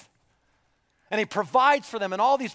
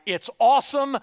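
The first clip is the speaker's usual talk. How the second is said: a higher, louder eager cheer; eager excitement; 7 semitones higher, 5 dB louder than usual. Retold or imitated. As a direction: sell the land with enthusiasm. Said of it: "were like".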